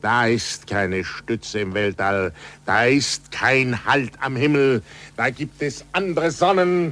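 rising to a proclamation; swelling from firm to ecstatic; building excitement. Acted. Galileo grows agitated.

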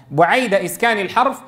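usual speaking voice

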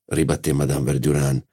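usual speaking voice

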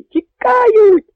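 very high, loud, slow whoops of triumph; sentry whooping as the Poles run; triumphant whooping. Acted. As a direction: crow over the fleeing enemy. Sentry sees the enemy fleeing.

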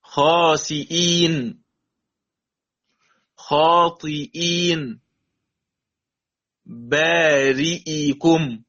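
خاسئين and خاطئين drawn out slowly with clear hamza; slow tajweed demo. Shown as a demonstration. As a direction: recite each word slowly as an example. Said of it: "مكسورة وقبلها مكسور". (قراءة الأمثلة ببطء وتجويد)

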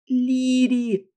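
high, loud wailing protest; wailing cry. Acted. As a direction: howl her name in dismay. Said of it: "взвыла Кася".

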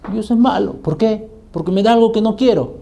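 put-on high little-girl voice talking back; defiant child, imitated. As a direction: answer back like a stubborn child. Hija desafía al padre, imitada. (niña respondona imitada, voz aguda)

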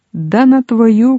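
high, drawn-out jeer; heckling shout. A narrator's reading. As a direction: shout from the crowd, stretching it. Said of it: "кричали з усібіч".

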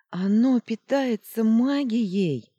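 high loud slow cry; desperate scream. Acted. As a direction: scream with all her might. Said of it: "изо всех сил закричала Луна". (отчаянный крик изо всех сил)

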